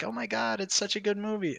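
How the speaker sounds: high-pitched mock moviegoer rapture; gushing fans. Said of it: "and they're like".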